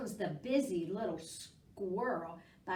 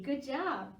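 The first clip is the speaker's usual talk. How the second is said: ordinary delivery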